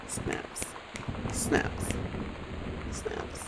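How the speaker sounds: voiceless, very soft whisper. Pretend. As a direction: whisper it as hushed applause, spaced out. Hushed self-applause after French demo.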